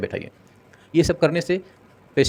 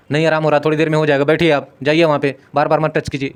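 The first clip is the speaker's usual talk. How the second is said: fast, louder irritated brush-off; impatient nurse shooing off a patient's family, irritated; rude impatient reply; 3 semitones higher, 5 dB louder than usual. Shown as a demonstration. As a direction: hurry them off, annoyed. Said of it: "ये सब चीज़ें नहीं बोलना चाहिए".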